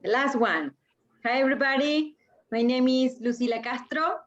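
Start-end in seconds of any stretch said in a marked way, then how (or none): none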